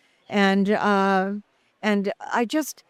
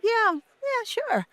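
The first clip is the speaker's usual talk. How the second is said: mock-naive, much higher and softer; the hustler acting innocent to lure in the lawyers; fake innocent agreement; 13 semitones higher, 6 dB quieter, slower than usual. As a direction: play the sweet little blonde who has never played. Feigning innocence before hustling Scrabble.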